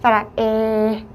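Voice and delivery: 사라에 slow with spread smiling lips; smiling vowel. Shown as a demonstration. Teacher shows the smile-lipped 에, slow. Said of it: "입술을 스마일 해주시고".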